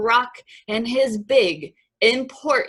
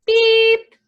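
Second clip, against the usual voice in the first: high, loud toy horn beep; one held, high-pitched beep; 14 semitones higher, 9 dB louder than usual. A narrator's reading. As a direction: beep like a cheerful little truck horn. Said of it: "said Blue".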